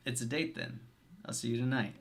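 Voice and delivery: soft flirty voice. Acted. Acted scene, hushed.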